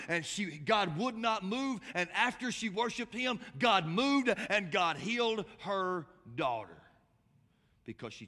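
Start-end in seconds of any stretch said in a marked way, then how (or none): 0.1-6.8 loud, high, slow sermon climax; triumphant preacher at full volume; full-force preaching. Real, not acted. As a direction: proclaim it with forceful, drawn-out words.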